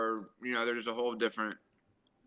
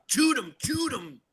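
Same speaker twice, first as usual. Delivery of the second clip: high, slow Cajun voice; Cajun swamp man; 11 semitones higher, slower than usual. Retold or imitated. Spotting an alligator from the boat, hammed Cajun call. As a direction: bark it like a Cajun hunter in the boat. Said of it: "they'll say".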